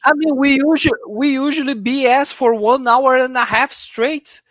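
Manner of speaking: high, loud, disbelieving protest; can't believe what he heard; incredulous. Real, not acted. Cohost objects to the claim.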